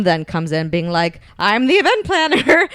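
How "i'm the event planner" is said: high, loud mock announcement; hammed-up mom. Teller playing his mom who comes in, hammed-up high announcement. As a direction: burst in as a comically shrieky mom. Mom bursting in, comically voiced.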